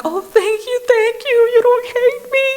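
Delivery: very high, relieved thanks; wife gushing thanks to a forgiving husband; gushing relief. Retold or imitated. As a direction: gush with relief like a little kid.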